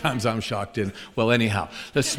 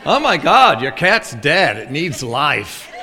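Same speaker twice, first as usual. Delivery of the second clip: mock exasperated; 6 dB louder, slower than usual. New believer answers the cat owner, mock exasperated. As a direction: blurt it out in comic disbelief.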